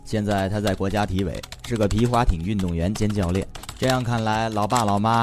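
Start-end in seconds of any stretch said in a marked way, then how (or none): none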